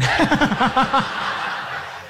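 airy unvoiced chuckle at the liars; breathy laugh. Real, not acted. Preacher laughing at lying news anchors, airy laugh. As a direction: let a breathless laugh slip out.

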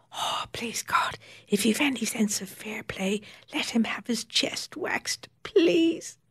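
breathless whispered plea to God; whispered prayer. A narrator's reading. Emma secretly prays for her doctor to suffer, whispering. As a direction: pray under your breath, hopeful. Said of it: "Emma prayed".

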